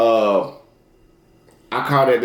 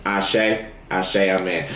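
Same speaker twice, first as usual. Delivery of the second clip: prayerful amen; 5 semitones lower, 3 dB quieter, much slower than usual. Real, not acted. Closing affirmation prayer.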